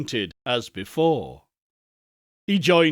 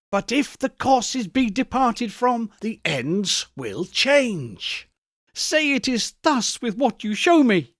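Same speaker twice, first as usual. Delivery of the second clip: put-on higher, pleading old man; pleading Scrooge; 8 semitones higher than usual. A narrator's reading. Scrooge pleads with the spirit, high and pleading. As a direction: beg the ghost, voice rising.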